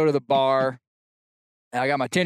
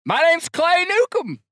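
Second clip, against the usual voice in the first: shouted answer; 5 dB louder than usual. Retold or imitated. Answering across loud bar.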